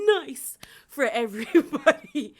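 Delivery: put-on tipsy slurring drunk voice; Dorinda drunk voice. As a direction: play it loose and boozy. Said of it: "That's my Dorinda drunk voice".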